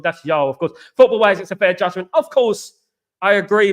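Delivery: ordinary talk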